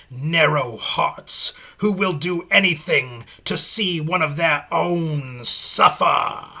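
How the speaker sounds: a lightly voiced demon lecturing; Pinhead's menacing speech. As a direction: draw it out with cold contempt.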